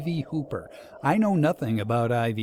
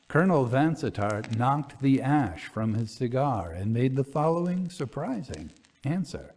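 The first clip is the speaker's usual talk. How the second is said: ordinary delivery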